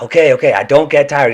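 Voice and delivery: forced declaration. Retold or imitated. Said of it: "he's like".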